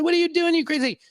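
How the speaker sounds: high, startled outcry; startled shout. Retold or imitated. John jolted awake, high startled cry. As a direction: jump up in pitch as if woken. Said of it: "John wakes up. He's like".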